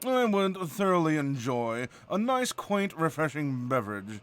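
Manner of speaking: nasal, drawn-out British bluster; very posh British, humming into the words. Shown as a demonstration. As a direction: bluster through it like a snooty Brit. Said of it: "a little bluster".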